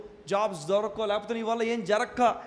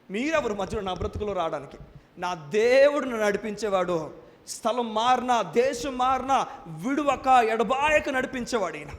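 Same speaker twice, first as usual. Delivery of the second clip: usual speaking voice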